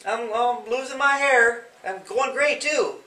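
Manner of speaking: loud, high, good-humoured yes; agreeing cheerfully with the bully about going gray; cheerful agreement. Shown as a demonstration. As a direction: happily own the joke about going bald. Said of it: "I could say".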